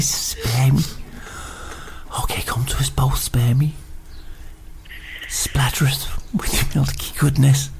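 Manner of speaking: whispered medium chant; psychic whisper. Acted. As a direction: summon the spirit in a hush. Fake psychic channelling spirits.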